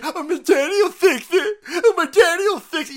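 high, loud fake blubbering; mock crybaby. Host mocking the son as a crybaby, high loud mock blubbering. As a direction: whine it like a sobbing toddler.

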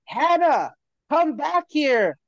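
mock yell, slow and drawn out; calling his sister back across the park; retold yell. Kid shouting after his sister, imitated. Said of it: "He screams out to his sister".

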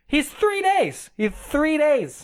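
high, drawn-out disbelief; indignant protest. Retold or imitated. Mother's protest about cancer remark.

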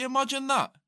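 high, loud incredulous cry; hammed-up disbelief at a bad joke; mock outrage. Mate reacting to a dark joke, high mock outrage.

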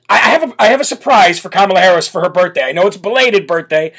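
ordinary delivery

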